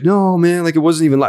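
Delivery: mock the guy's protest, voice lifted high; defensive denial. Mimics player's defensive denial.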